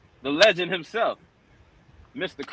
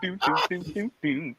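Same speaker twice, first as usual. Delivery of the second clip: booming doom doom footsteps; monster footsteps; 2 semitones higher, 5 dB quieter than usual. Pretend. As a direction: stomp it out like a monster. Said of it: "the sound that the monsters make".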